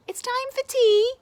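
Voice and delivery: high, loud sing-song call. Acted. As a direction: call him in from afar. Parents call Ned in.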